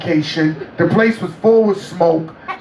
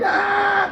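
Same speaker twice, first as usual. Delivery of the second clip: laughing burst; 11 semitones higher, 4 dB quieter than usual. Retold or imitated. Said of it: "I started laughing".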